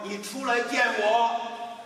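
high slow echo. Acted. The mountain echoes the boy, slow high echo. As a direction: let it ring back slowly.

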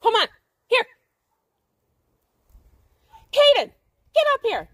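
loud yell to a wandering dog; shouting the dog's name. Real, not acted. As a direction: holler across the field for the dog. Calling dog from afar.

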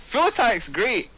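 high, loud, amused rant; excited. Real, not acted. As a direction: laugh it off, voice way up. Laughing at his own overkill.

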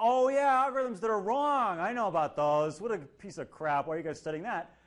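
loud, high scornful scoff; dismissive scoffing. Retold or imitated. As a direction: sneer at the idea, loudly. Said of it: "someone goes".

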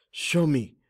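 imploring. A narrator's reading. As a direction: beg to be shown, pitch lifted. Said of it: "Amari implored".

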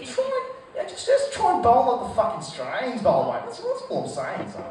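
a lightly voiced Australian coach. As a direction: coach giving tips in a hint of Australian accent. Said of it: "imagine the Australian coach who's".

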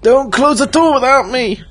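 high urgent yell; panicked shout. Real, not acted. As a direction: yell so they don't leave you. Left behind by teammates.

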